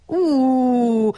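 high loud hooting wail. A narrator's reading. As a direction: another long hoot of dismay. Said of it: "tutult Pálinkós Gyurka".

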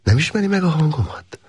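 a woman teasing through the door; a hint of a lighter female voice; woman's voice. A narrator's reading. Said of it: "mondta a nő".

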